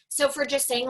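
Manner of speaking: ordinary delivery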